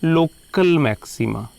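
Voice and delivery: Local slowed and pushed up, set against plain maxima; stress on local. Shown as a demonstration. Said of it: "इनको सिर्फ maxima नहीं बोलेंगे". (Local पर ज़ोर, धीमे और ऊँचे स्वर में)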